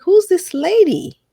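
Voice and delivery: high, loud, drawn-out wonder; retold amazement. Her marveling at the coach's free invitation, loud high amazement. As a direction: exclaim it high in amused disbelief.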